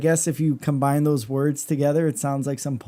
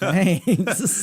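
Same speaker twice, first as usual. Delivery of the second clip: laughing; 2 semitones higher than usual. Retold or imitated. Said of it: "And I'm like".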